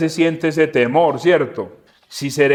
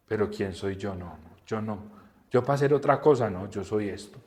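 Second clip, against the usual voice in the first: much softer, almost a murmur; hushed self-doubt; 7 semitones lower, 11 dB quieter than usual. Pretend. Voicing a doubting worker, quietly giving up. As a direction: shrink into the words.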